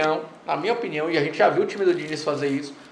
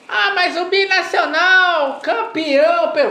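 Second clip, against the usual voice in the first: slow, high, sneering imitation; mocking quote; 11 semitones higher, 3 dB louder, much slower than usual. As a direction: parrot the rival fan with scorn. Mocking a rival fan's claim. (imitação debochada)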